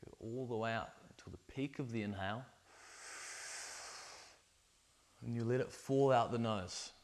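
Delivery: soft guided breathwork voice; guided meditation. Acted. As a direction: lead the breathing gently.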